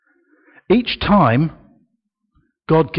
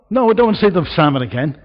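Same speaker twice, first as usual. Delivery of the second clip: laughing aside; 4 semitones higher, 6 dB louder, much faster than usual. Real, not acted.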